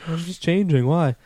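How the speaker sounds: high, loud startled voice; hammed-up flustered partner; caught off guard. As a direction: act shocked at being walked in on. Acting out caught-in-the-act skit.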